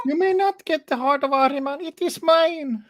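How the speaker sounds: very high, slowing at the end; high squeaky voice. Pretend. High voice joke continues.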